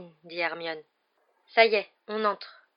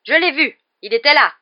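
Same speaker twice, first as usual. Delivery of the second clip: high, loud burst of fury; furious; 7 semitones higher, 13 dB louder than usual. A narrator's reading. Executioner insisting to the others, furious. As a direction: insist on it in an angry shout. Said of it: "dit le bourreau avec fureur".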